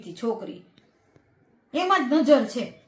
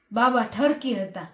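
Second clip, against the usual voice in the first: normal talking voice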